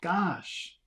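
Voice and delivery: acted wide-eyed surprise; surprised. A narrator's reading. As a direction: read it with childlike astonishment. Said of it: "what you say when you're surprised".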